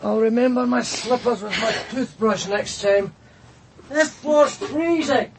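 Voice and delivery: pitched high and slow, chilled to the bone; shivery high-pitched grumble; cold and shivering. Acted. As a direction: complain of the freezing floor, voice pinched up high. Barefoot on a cold floor.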